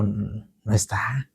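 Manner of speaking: whisper. Retold or imitated. Telling friend the house vanished.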